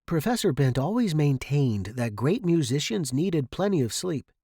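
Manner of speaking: plain reading voice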